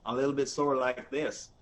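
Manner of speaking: slow North Texas drawl; North Texas accent. Shown as a demonstration. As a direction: demonstrate a North Texas accent, taking it slowly. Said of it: "it talks slower".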